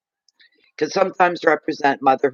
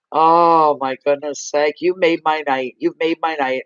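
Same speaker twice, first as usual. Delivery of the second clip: usual speaking voice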